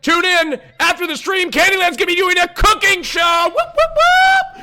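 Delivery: high, loud hype shout. Real, not acted. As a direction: hype it up like an announcer. Hyping a guest's cooking show.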